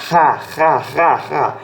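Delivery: "Ha" as a rough breath from the throat; throaty aspiration. Shown as a demonstration. Teacher showing the aspirated letter, throaty "ha" sounds. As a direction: repeat "ha" with a guttural, aspirated H. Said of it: "mais na garganta".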